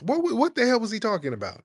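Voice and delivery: fast, high-pitched incredulity; baffled. Real, not acted. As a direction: stammer out your disbelief in a high pitch. Baffled host complaining about caller.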